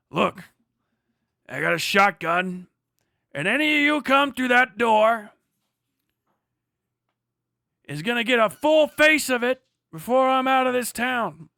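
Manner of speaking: hammed-up outlaw shouting his warning; menacing gunfighter threat. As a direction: threaten the whole saloon from the doorway. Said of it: "whatever the fuck it is he says".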